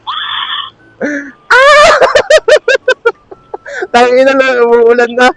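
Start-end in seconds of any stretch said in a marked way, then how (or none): 1.0-3.8 squealing burst of laughter; high-pitched laugh. Real, not acted. Laughing at getting soaked.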